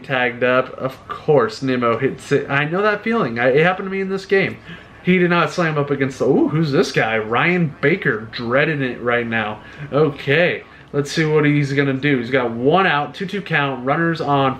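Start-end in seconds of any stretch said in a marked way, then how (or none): none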